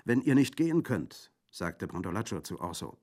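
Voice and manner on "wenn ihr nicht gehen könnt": urgent bandit. Acted. Bandit urging.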